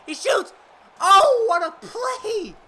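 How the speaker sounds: excited call. Acted. Announcer shouting the big save to viewers, high and loud. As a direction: belt it out like a thrilled announcer.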